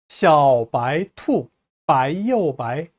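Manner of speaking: slow, each syllable drawn out; slow model reading. Shown as a demonstration. Teacher modelling the first line for learners, slowly. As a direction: read it out slowly for learners to copy.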